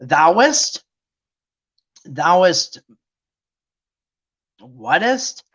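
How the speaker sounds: stumbling, questioning puzzlement; mock confusion. Preachers baffled by old English wording, mock confusion. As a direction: puzzle over each odd word, with long pauses. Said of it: "it was confusing the heck out of the preachers".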